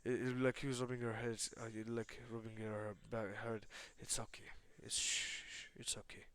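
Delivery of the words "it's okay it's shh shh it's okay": soft, whispery comforting shush; soothing hush. Acted. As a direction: hush someone gently, barely voiced. Izuku soothing the crying Lilith.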